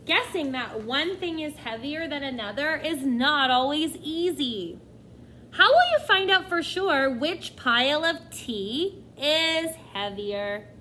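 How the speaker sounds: usual speaking voice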